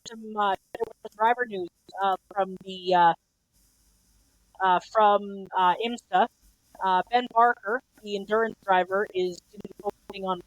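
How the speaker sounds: muffled, as if through a hand. Real, not acted. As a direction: voice blocked and dull on the line. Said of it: "you're going very muffled right now".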